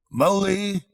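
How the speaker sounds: high call across the house. A narrator's reading. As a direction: call the friend's name from another room. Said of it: "Then he called".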